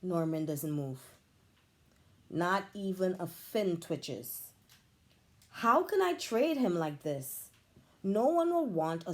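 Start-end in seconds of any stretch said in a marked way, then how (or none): none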